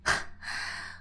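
short breathy disbelieving laugh; a scoff of a laugh; incredulous laugh. Acted. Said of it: "失笑出声".

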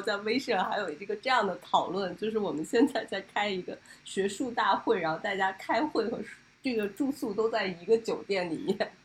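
normal talking voice

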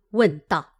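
plain reading voice

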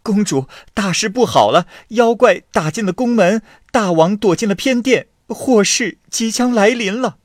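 high, loud, breathless panic; a frightened palace maid blurting out bad news; panicked report. A narrator's reading. Palace maid rushes in to warn the princess, high panicked cry. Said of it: "一个宫女慌慌张张地跑了进来".

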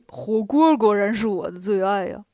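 doltish, relishing pig-man voice; food-loving pig disciple; gluttonous Bajie. Acted. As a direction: savour it as a greedy Bajie. Bajie relishes the hotpot joke.

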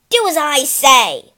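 loud, forceful order; shouted command. Acted. Cruel owner to the horse being broken in, shouting. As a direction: raise your voice and demand obedience.

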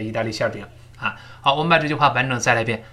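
ordinary talk, nothing marked

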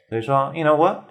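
ordinary talk

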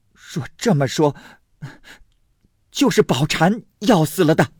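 high-pitched flustered accusation; anxious, agitated. A narrator's reading. Alarmed mother accusing the maid, agitated. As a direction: hit back in a panic, pitch up. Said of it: "金贵的母亲着了急道".

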